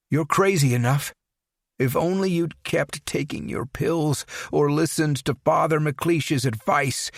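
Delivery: lightly voiced accusing father. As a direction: lay the blame on the son. Father accuses his son.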